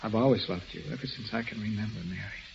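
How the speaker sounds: soft confession. Acted. Bill confesses love to Mary.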